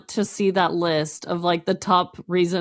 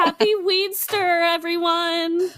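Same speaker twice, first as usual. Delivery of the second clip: festive greeting; 8 semitones higher, slower than usual. Retold or imitated. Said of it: "I was like".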